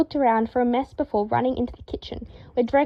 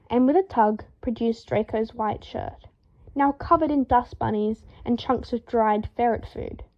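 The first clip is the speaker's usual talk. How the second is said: usual speaking voice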